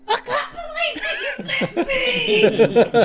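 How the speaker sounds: high, strained, laughing goblin wail; strained goblin cry. Acted. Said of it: "a strained".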